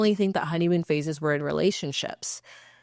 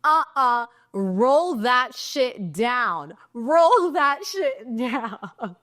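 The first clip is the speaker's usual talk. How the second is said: higher, drawn-out mock holler with a Texas streak; retold scream; 5 semitones higher, much slower than usual. Driver yelling at a man out her window, drawn-out mock scream. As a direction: holler it slowly, stretching the words. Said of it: "start screaming out my window".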